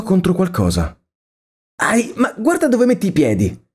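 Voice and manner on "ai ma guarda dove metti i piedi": put-on much higher young woman's voice, annoyed; girl scolding the young man who bumped into her; irritated girl. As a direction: complain like a girl who just got bumped. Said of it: "si lamentò una voce femminile".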